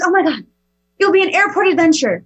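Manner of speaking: excited daughter. Retold or imitated. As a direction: sell the adventure with wide eyes. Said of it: "she goes".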